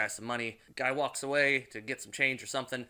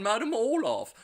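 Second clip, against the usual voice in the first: lightly hammed-up, higher lady's voice; funny clairvoyant voice; 11 semitones higher, 8 dB louder than usual. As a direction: say the name as the eccentric clairvoyant would. Names clairvoyant in her voice.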